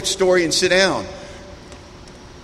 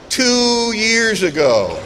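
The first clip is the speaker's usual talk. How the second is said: very slow, stretched-out retort; drawn-out reply; 2 semitones higher, much slower than usual. Retold or imitated. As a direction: imitate her, dragging out every word. Woman's reply to disastrous question.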